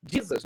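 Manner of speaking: "Jesus" said the English way, "JEE-zus"; "Jesus" as in English, stressed on the first syllable; English pronunciation. Shown as a demonstration. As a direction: start "Jesus" with an English DJ sound. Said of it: "no inglês".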